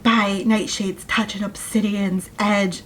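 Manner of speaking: slow mock spell chant; mildly hammed-up magic words; spell incantation. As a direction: intone it like a silly incantation, slowly. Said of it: "repeat the ridiculous phrases".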